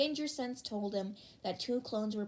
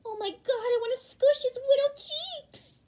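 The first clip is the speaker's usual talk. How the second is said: much higher, louder cooing squeal; squealing fan; 8 dB louder than usual. Acted. Toru squealing at young Deku's photo, high cooing. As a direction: coo over a cute photo. Toru gushing over baby pic.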